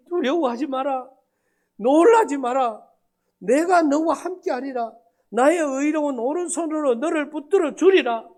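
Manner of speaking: scripture recited through tears, half-acted; mock tearful plea. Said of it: "그걸 하면서 울어요".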